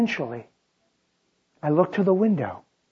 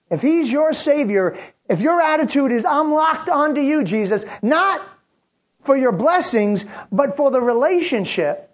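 high, loud fervent preaching; preaching at full force; 7 semitones higher, 3 dB louder than usual. Acted. The preacher urging the congregation, fervent and loud. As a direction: drive the point home.

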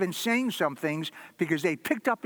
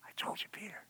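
whisper; 2 semitones higher, 15 dB quieter, faster than usual. Acted. Jesus' look voiced.

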